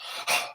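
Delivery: demonstrated breathy gasp; short voiceless gasp of shock; sharp gasp. Shown as a demonstration. Actor showing a back-stabbed man's gasp. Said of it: "They can only".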